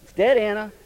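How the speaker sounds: high, loud calls to stop hacking; calling out loud. Retold or imitated. Father yelling at his daughter that the snake is dead. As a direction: shout her name to make her stop. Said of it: "calling the daughter to stop".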